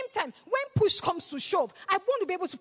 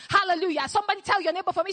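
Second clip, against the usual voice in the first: usual speaking voice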